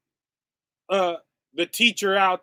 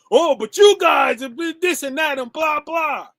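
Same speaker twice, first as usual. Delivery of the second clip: higher, louder, whining excuse; mock whiny excuse; 6 semitones higher, 5 dB louder than usual. Preacher mocking the leader's excuses to the congregation, whiny mimicry. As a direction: mimic someone wriggling out of blame. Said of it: "gonna blame me".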